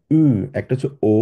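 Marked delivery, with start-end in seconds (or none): 0.1-0.2 উ with fully rounded lips, German ö. Shown as a demonstration. Teacher coaching the lip shape, উ as a rounded ö. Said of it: "গোল রেখে আমরা বলার চেষ্টা করবো". 1.1-1.2 ও as an ordinary back o; simple o. Shown as a demonstration. Teacher contrasting the plain letter with umlaut, ও as simple o. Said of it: "একদম simply আমরা ও বলি".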